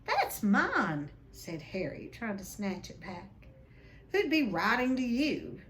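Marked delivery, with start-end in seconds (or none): 0.0-1.1 put-on protesting boy. Harry protests in story. 4.1-5.6 sneering. Acted. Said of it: "sneered Uncle Vernon".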